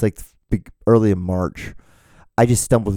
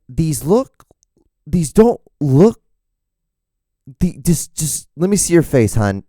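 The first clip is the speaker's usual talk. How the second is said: coaxing photographer; 5 semitones higher, slower than usual. Retold or imitated. Mock photographer coaxing Helen.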